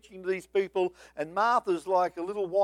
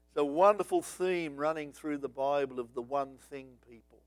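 ordinary delivery

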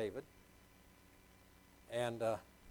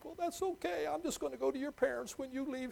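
higher, mock-tearful voice; mock crying; 11 semitones higher than usual. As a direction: fake a sob in the voice, pitched up. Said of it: "it's like I'm crying".

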